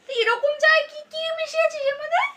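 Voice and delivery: high, loud, indignant questioning; angry accusation. Real, not acted. Husband accusing wife over spicy tea, angry.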